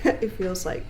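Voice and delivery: ordinary talk, nothing marked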